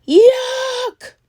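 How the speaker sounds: high shrieking monster yuck; monster yell. Acted. A kissed monster yelling in disgust, high shriek. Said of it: "The monster yelled".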